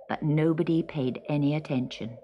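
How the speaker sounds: ordinary delivery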